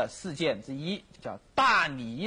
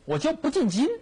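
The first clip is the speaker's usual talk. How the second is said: high-pitched, emphatic refusal; acted defiant ultimatum; 8 semitones higher, 3 dB louder than usual. Emperor's mother refuses to enter the capital, defiant. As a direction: put on a stubborn mother digging in.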